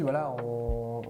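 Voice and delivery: ordinary talk, nothing marked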